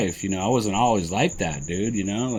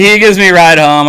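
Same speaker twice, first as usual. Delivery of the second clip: louder, pushing over the others talking; raised over crosstalk; 7 semitones higher, 11 dB louder than usual. Real, not acted. Telling his story while friends talk over him, raised voice. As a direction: take the floor back firmly. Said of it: "restarts the line over crosstalk".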